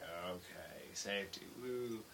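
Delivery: quiet, low mock cheer; unenthused agreement. Crew answering foreman's safety talk, unenthusiastic. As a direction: feign excitement with no energy at all.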